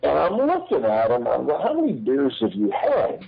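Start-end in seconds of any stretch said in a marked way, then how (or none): 1.6-3.2 incredulous retort. Retold or imitated. Incredulous reaction to the gimmick pitch.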